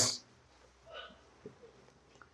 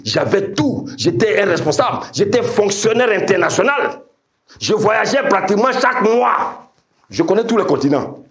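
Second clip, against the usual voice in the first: higher-pitched, mounting testimony; emphatic preaching; 9 semitones higher than usual. Real, not acted. Preacher listing his former status to the congregation, emphatic preaching. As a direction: build the list upward in pitch.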